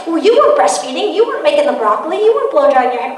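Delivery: loud, acted self-justification; defensive. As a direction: throw the blame back, mock-indignant. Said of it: "You get defensive".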